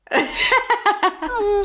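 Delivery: high squeaky mock sneeze; playful high "achoo"; mimicked sneeze. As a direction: imitate someone's little sneeze, amused. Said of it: "I love how you were like".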